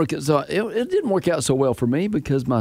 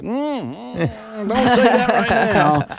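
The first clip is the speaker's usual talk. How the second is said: warning parent voice; 10 semitones higher, much slower than usual. Retold or imitated.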